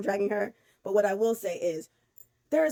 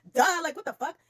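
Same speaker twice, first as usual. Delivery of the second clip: pitched up, louder disbelieving "duh"; incredulous retort; 6 semitones higher, 4 dB louder than usual. Retold or imitated. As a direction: throw it back like it's obvious. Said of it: "And I was like".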